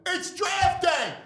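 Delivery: slow, high hyped shout; excited. Real, not acted. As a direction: announce it big. Hyped show opener.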